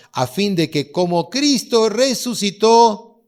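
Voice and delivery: preaching at full voice; full-force preaching. Acted. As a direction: proclaim it to the whole church. Preacher proclaims verse loudly.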